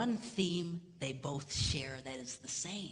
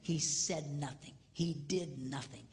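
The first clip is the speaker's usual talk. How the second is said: slow, deliberate, weighty; slow emphatic; slower than usual. Real, not acted. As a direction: let each word land. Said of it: "sermon emphasis".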